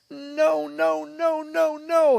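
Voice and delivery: high, loud string of refusals; retold child's screaming. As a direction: imitate a panicked kid refusing over and over. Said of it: "that would be me the whole time screaming".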